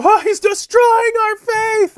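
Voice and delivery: high, slow mock wail of alarm; mock outcry. Muslims reacting after the blunt debate.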